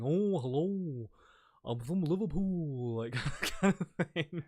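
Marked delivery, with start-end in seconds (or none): 0.0-3.0 put-on slow, drawled old-school Scouse accent. Shown as a demonstration. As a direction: stretch the words out like an older Scouse speaker. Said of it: "the old school one would be like".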